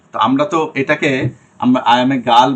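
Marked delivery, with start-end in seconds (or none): 1.9-2.5 put-on "girl" said as "gaal"; wrong open vowel. Shown as a demonstration. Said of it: "বলি না, তাই না?". ("girl" খোলা আ দিয়ে "গাল")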